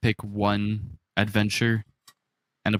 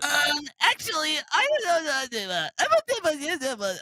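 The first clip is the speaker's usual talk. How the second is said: put-on high, cute anime girl voice; squeaky anime girl act. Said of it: "I perfected my anime girl voice".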